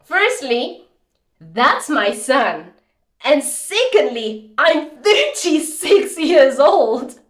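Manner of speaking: high and loud, slow and pointed on each fact; mother correcting the young lifeguard, indignant and slow; indignant retort. Retold or imitated. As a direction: set him straight, word by word. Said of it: "I'm like".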